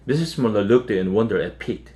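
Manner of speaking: usual speaking voice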